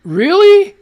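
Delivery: loud high disbelieving really; incredulous loud shout; outraged really. Real, not acted. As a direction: throw it out in disbelief. Indignant at closed-door hearing.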